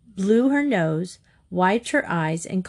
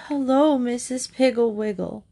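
high, mournful storybook hello; sorry little phone greeting; sad, ashamed; 5 semitones higher than usual. A narrator's reading. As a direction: greet her plaintively, full of shame. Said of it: "was so sad, ashamed".

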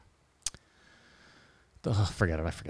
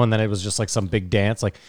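usual speaking voice

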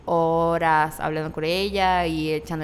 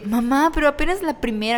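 high, loud disbelief at mom; incredulous; 8 semitones higher, 4 dB louder than usual. Retold or imitated. Shopping with mom, high incredulous protest. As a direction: exclaim in disbelief. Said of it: "Y yo así de".

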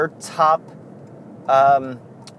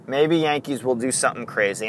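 usual speaking voice

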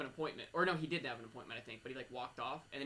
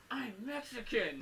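high, drawn-out mock cry; mimicked outburst; 7 semitones higher, slower than usual. Co-host replays what the radio guy heard, mimicked outburst. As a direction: imitate the outburst slowly and high-pitched. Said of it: "be the guy on the radio".

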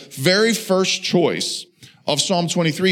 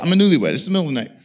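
normal talking voice

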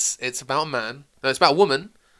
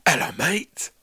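whispered "Hello, mate." in Cockney; breathy Cockney greeting like a hard man; Cockney impression; slower than usual. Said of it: "Jason Statham, isn't it?".

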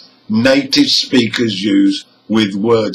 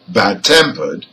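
"Bad-tempered" with the weight on TEM; stress on TEMPERED; 5 semitones lower than usual. Shown as a demonstration. Teacher gives a compound adjective example, "Bad-tempered" stressed on TEM. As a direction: keep Bad light, hit TEMpered in "Bad-tempered". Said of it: "the stress is on the second part".

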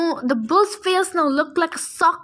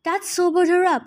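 plain reading voice

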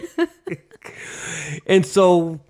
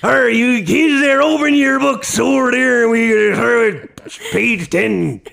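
slow, loud pirate barking orders; a pirate captain teaching class; pirate teacher voice; 9 semitones higher, 4 dB louder than usual. Acted. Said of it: "the pirate teacher".

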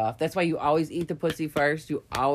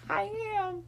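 breathy eager yes; 7 dB quieter than usual. Retold or imitated. Eager reply to lover's question.

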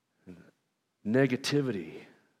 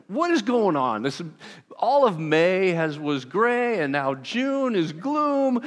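mock grumbling; 6 semitones higher, 4 dB louder, slower than usual. Shown as a demonstration. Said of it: "I've been grumbling about the weather".

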